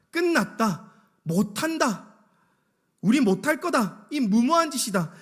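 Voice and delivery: high-pitched acted outburst of grumbling; fed-up complaining. Preacher voices Israelites grumbling over rebuilding, exasperated and high. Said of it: "백성들의 입에서 이제는 불만과 불평이 나오기 시작하는".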